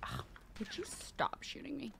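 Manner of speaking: hushed annoyed whisper at the enemy. Real, not acted. Player whispering at the man shooting him. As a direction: whisper it, fed up with being shot.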